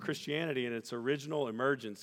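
stirring quoted passage; reading a quote. Acted. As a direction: quote read in a rising preaching voice over music. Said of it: "Elton Trueblood says this".

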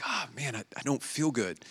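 barely voiced, feeble sick friend; weak sick whisper. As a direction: whisper it like you're too ill to talk. Sick friend curled up moaning.

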